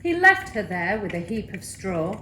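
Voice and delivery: ordinary delivery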